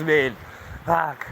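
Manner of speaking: normal talking voice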